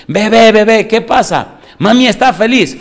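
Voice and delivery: high, loud cheerful calling; hormone voice. Pretend. Hormone telling the baby that mommy is happy, high cheerful call. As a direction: bring the good news to the baby.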